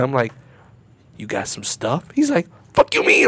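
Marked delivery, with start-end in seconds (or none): 1.2-2.0 low, soft undertone; furtive ask. Retold or imitated. Covertly asking a stranger. 2.7-3.3 high-pitched mock outrage; indignant retort. Stranger snapping back in the story.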